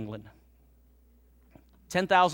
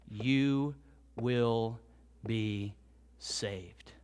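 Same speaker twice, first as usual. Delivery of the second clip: slow, sinking lower and softer word by word; slowing to a hush; 4 semitones lower, 4 dB quieter, much slower than usual. Acted. Pastor reading the verse to the church, slowing into a hush. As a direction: let each last word drop into near silence.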